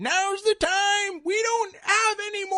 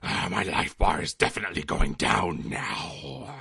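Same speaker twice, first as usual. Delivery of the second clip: pained deep groan; slower than usual. Acted. Character taking heavy damage.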